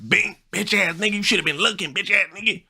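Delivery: high, put-on taunting voice; mock taunt voice. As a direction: jeer it in a squeaky mock voice. Mocking someone's taunt.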